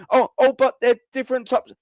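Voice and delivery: high whiny mock voice; mocking fans. Mocking melt fans defending the substitutions, high and whiny. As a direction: whine it like a silly fan. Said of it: "mocking melt fans".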